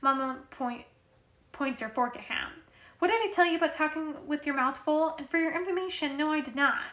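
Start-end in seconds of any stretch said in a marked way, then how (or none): 3.0-6.8 higher, louder mock-stern mom; raised-voice motherly telling-off; motherly scolding. A narrator's reading. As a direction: scold a child as their mother. Mother scolds talking with mouth full.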